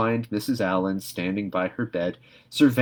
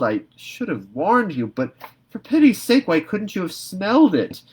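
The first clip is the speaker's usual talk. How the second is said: put-on loud, higher scolding old woman; exasperated Marilla; 6 semitones higher, 5 dB louder than usual. A narrator's reading. Marilla scolding Anne about the liniment, loud and exasperated.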